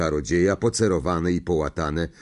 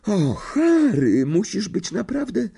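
high, slow, alarmed schoolgirl voice; anxious girl; 11 semitones higher than usual. A narrator's reading. Hermiona frets over Harry, alarmed high voice. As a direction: plead with him to be careful, voice raised high.

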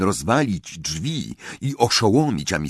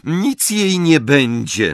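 high, slow reassurance with impatience; impatient; 7 semitones higher, 4 dB louder, slower than usual. A narrator's reading. Professor finishes examining the sister, impatient. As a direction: dismiss the worry impatiently. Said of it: "powiedziała niecierpliwie".

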